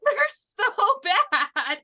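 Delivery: "They're so bad" laughed out high and slow; laughing. Real, not acted. Friend laughs at the terrible accents, laughing through it. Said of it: "crying with laughter".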